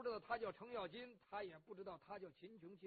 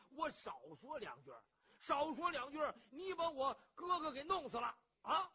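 louder and higher, throwing it back; indignant shout; 5 semitones higher, 6 dB louder than usual. Acted. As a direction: roar the retort back at him. Cheng retorts loudly.

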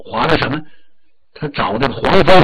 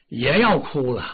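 plain reading voice

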